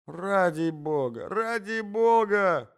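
slow, rising, drawn-out plea; pleading cry. A narrator's reading. Intruder crawling out from under bed, pleading cry. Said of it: "кричал Иван Андреевич".

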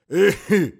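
very loud, high hacking fit; old man's cough. Acted. Old husband interrupts himself before his wife, violent cough. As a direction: burst into a long acted coughing fit.

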